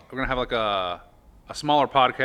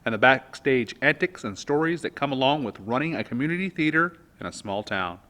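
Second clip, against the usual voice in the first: louder, upbeat host opening; show host welcome; 4 semitones higher, 5 dB louder than usual. Acted. Host opening the podcast for listeners, upbeat welcome. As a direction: welcome the audience like a showman.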